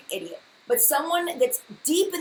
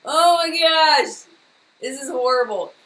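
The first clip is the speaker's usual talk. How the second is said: loud, higher, slow exasperated groan; loud drawn-out exasperation; frustrated outburst; 4 semitones higher, 10 dB louder than usual. Real, not acted. Frustrated by stream glitch.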